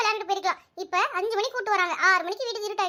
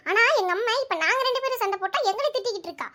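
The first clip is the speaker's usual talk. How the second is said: ordinary talk, nothing marked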